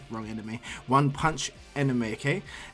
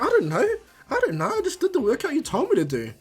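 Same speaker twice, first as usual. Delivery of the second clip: high, loud plea of innocence; defensive whine; 11 semitones higher, 4 dB louder than usual. Acted. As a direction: protest helplessly with the pitch way up. Naruto pleads ignorance when challenged.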